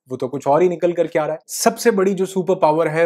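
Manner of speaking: usual speaking voice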